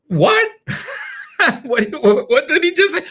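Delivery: high, laughing disbelief; baffled listener. Retold or imitated. Listener who missed the phone number, high and baffled. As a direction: squeal in confusion. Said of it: "confused voicemail listener".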